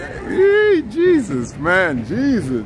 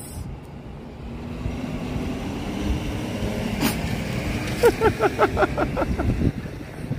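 laughing; 12 semitones lower, 8 dB quieter than usual. Real, not acted. Breaks up after rant.

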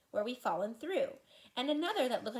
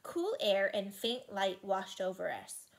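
ordinary delivery, nothing marked

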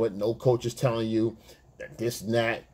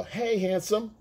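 flirty woman; 10 semitones higher, slower than usual. Retold or imitated. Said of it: "and they go".

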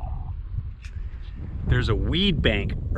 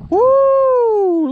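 excited whoop; 9 dB louder than usual. Real, not acted. Angler lands a big bass.

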